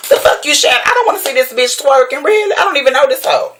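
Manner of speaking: fed-up disbelief, pitch soaring; exasperated outburst. Real, not acted.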